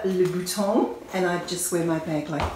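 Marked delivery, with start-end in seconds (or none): 0.0-0.9 put-on le bouton said with a playful French accent, nasal on bouton; mock French. Playful French word swap.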